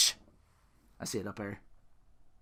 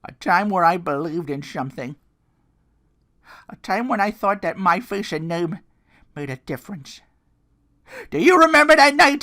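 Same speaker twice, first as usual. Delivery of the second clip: loud, high, ranting wrestling promo voice; impassioned Mick Foley promo yell; Mick Foley impression; 9 semitones higher, 8 dB louder than usual. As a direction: scream the promo like a wounded hardcore legend. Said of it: "my Mick Foley is already not that good".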